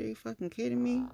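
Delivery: high, quick disbelief; incredulous retort. Retold or imitated. Telling him she isn't stupid, high incredulous retort. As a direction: toss it out fast and high, incredulous. Retort to being called smart.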